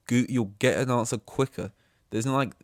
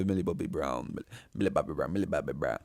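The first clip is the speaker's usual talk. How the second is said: lower, softer sing-song name; playing with the name; 6 semitones lower, 6 dB quieter, faster than usual. Savouring saying the name.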